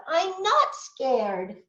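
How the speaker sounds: a hint of a brave little monster, slow; slow, insisting she isn't scared; lightly voiced child. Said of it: "said the Gruffalo's child".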